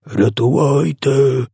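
high, drawn-out cry for help; screeching. A narrator's reading. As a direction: screech it slowly, like a panicking giant. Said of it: "верещав тілогриз". (протяжний вереск)

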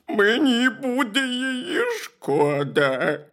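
high, slow, drawn-out wail; crying bear cub. A narrator's reading. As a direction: wail it as an inconsolable little bear. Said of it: "ведмедик аж так розплакався".

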